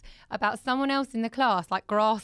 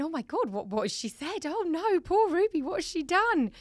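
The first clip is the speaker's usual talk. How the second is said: higher, dramatised alarm; worried inner voice; 8 semitones higher than usual. Retold or imitated. Mum retelling her panic over poor Ruby, dramatised worry. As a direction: play up a parent's dread and pity.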